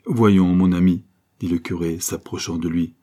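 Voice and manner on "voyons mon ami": louder, insistent appeal; urging priest. A narrator's reading. Priest approaching his wavering friend, urging. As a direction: press a hesitant friend firmly.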